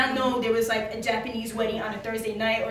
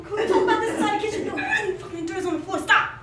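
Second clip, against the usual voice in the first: high acted bickering through laughter; high-pitched mock argument; 8 semitones higher than usual. Staff arguing with boss about the kitchen, high mock bickering. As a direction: play both sides of the squabble, pitched up. Acted quarrel with Ron in story.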